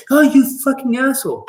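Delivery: sudden high, loud angry shriek; mother screaming at her child; mom yelling. Retold or imitated. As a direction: snap at the kid like an angry mother. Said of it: "when your mom's like".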